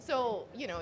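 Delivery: ordinary talk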